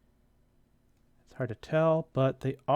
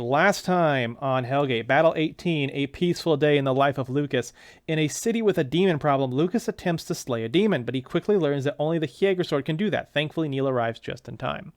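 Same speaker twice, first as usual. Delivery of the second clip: announcer-style recap of last issue; recap narration; 7 dB louder than usual. Acted. Narrator recapping last issue, announcer style. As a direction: read it like a show's "previously on". Recap read in series-intro voice.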